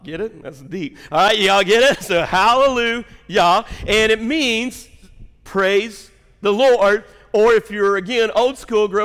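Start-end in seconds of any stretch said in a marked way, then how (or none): 1.1-9.0 preaching. Acted. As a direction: drive the word home to the congregation. Preaching rises in force.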